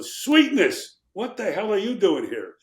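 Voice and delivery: joyful shocked greeting across the room; surprised shout. Retold or imitated. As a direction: call out in disbelief at a friend. Surprised greeting to Walter Payton.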